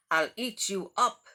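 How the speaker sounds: loud, cheeky monster threat; wild boy threat. Acted. Max threatens his mother, loud and cheeky. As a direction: roar it like a wild little boy. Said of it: "and Max said".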